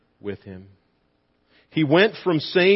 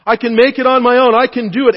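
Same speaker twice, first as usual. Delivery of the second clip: loud, high, straining swimmer; a swimmer telling himself he'll reach the bank; determined cry; 8 semitones higher, 9 dB louder, faster than usual. Pretend. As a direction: shout your confidence against the current. Voicing self-reliance.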